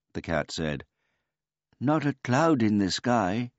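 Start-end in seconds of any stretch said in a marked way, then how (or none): 1.8-3.5 put-on high, polite old man voice; a gentle, childlike old man; simple old man. A narrator's reading.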